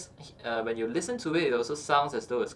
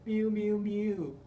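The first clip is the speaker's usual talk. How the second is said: soft kitten-like mew, higher; 7 semitones higher, much slower than usual. Shown as a demonstration. Coach demos the mew drill, soft high mews. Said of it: "another exercise you could do is mew".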